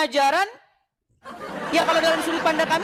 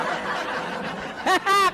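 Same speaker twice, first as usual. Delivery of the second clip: airy burst of laughter; breathless laugh; 5 semitones higher than usual. Real, not acted. Vlogger laughs as his opponent walks off, breathy laughter. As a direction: laugh under your breath, delighted.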